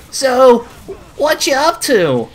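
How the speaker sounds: high-pitched, drawn-out pick-up line; high, teasing character voice; mock flirty come-on. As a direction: lift the pitch way up for a cartoon suitor. Voicing Gil in comic retelling.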